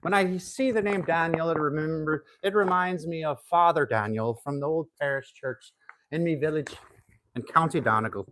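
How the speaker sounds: lightly put-on Irish accent; a hint of Irish brogue. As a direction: reminisce like an old Donegal man. Fond memory in put-on Irish.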